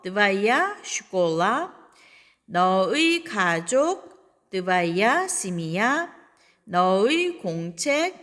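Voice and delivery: slow, clear teacher drill; slow model reading. Shown as a demonstration. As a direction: say each pair slowly for students. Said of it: "너의 넣어서 말해봅니다". (천천히 또박또박 시범)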